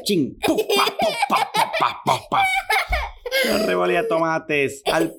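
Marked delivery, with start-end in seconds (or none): 0.4-2.4 high popping splat sounds, laughing; playful high cartoon splats; tomato splat noises. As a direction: mimic tomatoes bursting on glass. Tomatoes hitting windshield.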